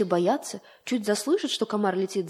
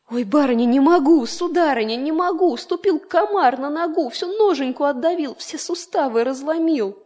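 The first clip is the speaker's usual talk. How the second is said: higher, louder wailing complaint of pain; groaning groom; 6 semitones higher, 6 dB louder than usual. Acted. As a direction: moan about the crushed foot. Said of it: "заохал, застонал". (жалобно, со стонами)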